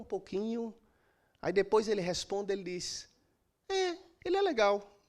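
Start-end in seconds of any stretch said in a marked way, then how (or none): 3.7-5.0 "É, ele é legal" said noticeably higher, a little forced; pitched-up hesitant reply. Retold or imitated. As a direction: lift the voice to show the doubt. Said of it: "Um tom de voz acima do normal da voz dele". ("É, ele é legal" numa voz mais aguda e hesitante)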